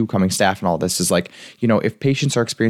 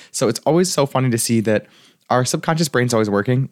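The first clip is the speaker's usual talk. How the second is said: ordinary talk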